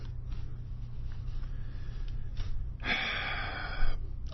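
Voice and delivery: long, soft breath out; sigh, at a loss; drawn-out weary exhale; frustrated sigh. Real, not acted.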